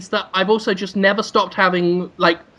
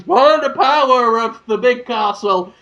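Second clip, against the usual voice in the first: mock heroic cry; 3 semitones higher than usual. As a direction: proclaim it like a cartoon hero. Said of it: "gets a sword and goes".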